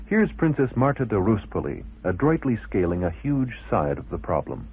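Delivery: ordinary talk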